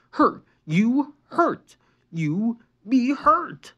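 high, slow, broken angry words; angry drawl. A narrator's reading. Injured boy accusing his sister, angry drawl. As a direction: drag the words out in anger. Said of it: "Lincoln drawled angrily".

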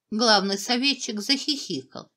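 usual speaking voice

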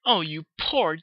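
high, loud, drawn-out fussy sympathy; hammed-up ladylike fretting. A narrator's reading. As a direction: fuss over a friend, slowly and dramatically.

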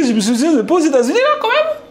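high, fast, laughing through the words; laughing in disbelief. Real, not acted. Laughing in disbelief at the man who speaks no English. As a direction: laugh it out, can't believe it.

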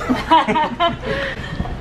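high bright laugh; high giggle. Real, not acted. Laughs at "free lessons.

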